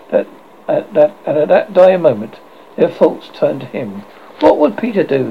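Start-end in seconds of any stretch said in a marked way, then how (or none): 4.4-5.3 higher-pitched eager cry. A narrator's reading. The boys all turn their thoughts to Peter, crying out. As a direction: call out the question, pitch lifted. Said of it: "they cried simultaneously".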